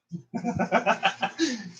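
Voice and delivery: high, rapid giggle; a run of high-pitched chuckles; laugh. Real, not acted. Laughs after fumbling about.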